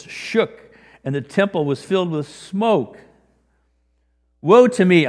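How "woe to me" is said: much louder, higher anguished cry. Acted. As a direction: cry it out in despair before God. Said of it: "I cried".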